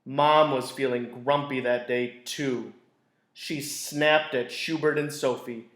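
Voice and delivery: gentle storybook narration; teacher reading a picture book to a class; reading to children. A narrator's reading. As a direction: read it like circle time for kids. Reads picture book aloud.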